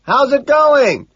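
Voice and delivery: slow, high, loud holler; bellowed high greeting; shouting. A narrator's reading. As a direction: shout it with every word drawn out. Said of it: "I shout".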